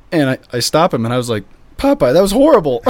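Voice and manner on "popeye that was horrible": high, loud comic scolding; mock outrage. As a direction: berate a bad performer in jest. Mock scolding of the busker, retold.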